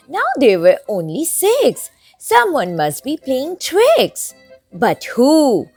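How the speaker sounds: ordinary delivery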